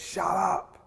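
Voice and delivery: mock-yelled rebuke from the cross; yelling thief. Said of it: "the other thief yells at him".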